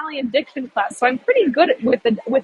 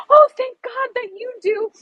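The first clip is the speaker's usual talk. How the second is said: relieved lady; 11 semitones higher, 3 dB louder than usual. Retold or imitated. Relieved tourist finds German speaker.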